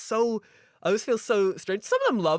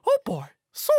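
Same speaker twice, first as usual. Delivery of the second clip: Mickey Mouse voice; 7 dB louder than usual. Pretend. Kingdom Hearts voices bit.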